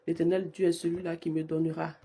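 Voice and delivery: ordinary delivery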